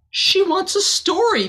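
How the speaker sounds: high, bright group call; chorus cry. Acted. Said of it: "they all cried".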